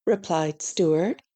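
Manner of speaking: ordinary delivery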